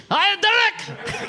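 high, slow laughing mock scream. Mocking the name as a dictator's scream. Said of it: "something Hitler screamed".

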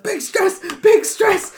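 very high panicked squeal; frantic squeal. Acted. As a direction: squeal it as you dance around. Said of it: "And I was like".

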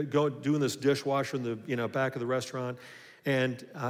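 ordinary delivery

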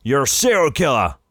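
mock sheriff shouting, high and loud; sheriff yelling. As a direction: bellow it at the punk like a hammed-up sheriff. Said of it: "and he say".

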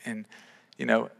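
ordinary delivery

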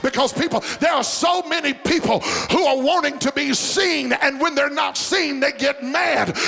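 high, driving sermon delivery building to a shout; rousing preacher in full cry; full-force preaching. Real, not acted. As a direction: preach it hard and high, stretching the climax.

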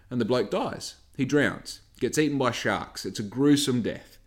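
normal talking voice

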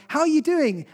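cheery greeting. Retold or imitated. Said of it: "you're like".

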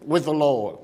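building sermon cadence, calling them out; rising preaching. Acted. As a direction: press the congregation with every question.

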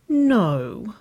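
high, falling groan of "no"; groaned protest. A narrator's reading. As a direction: whine it in pleading protest. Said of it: "Nicole groaned".

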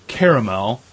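ordinary talk

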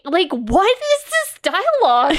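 incredulous. Retold or imitated. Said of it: "And I'm like".

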